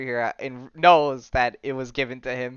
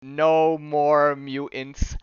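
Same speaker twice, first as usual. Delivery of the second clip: very slow, spaced-out pronouncement; weighty, drawn-out decree; dramatic famous line; much slower than usual. Acted. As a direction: draw out each word like a fateful spell. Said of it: "do you wanna say the lines?".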